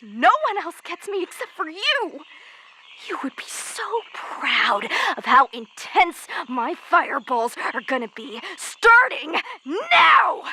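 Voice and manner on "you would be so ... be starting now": starts hushed, ends in a full shout; building to a shout. Acted. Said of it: "I thought screaming and yelling".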